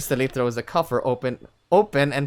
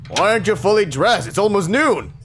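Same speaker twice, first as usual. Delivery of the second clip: loud, raised-pitch reproach; stern scolding; 6 semitones higher, 8 dB louder than usual. Acted. Chief to undressed son at noon, scolding. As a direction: scold a late riser loudly.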